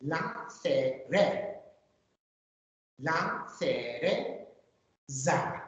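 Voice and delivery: slow, careful "La cereza"; drawn-out teacher model of "La cereza". Shown as a demonstration. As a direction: stretch out "La cereza" for learners. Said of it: "My turn".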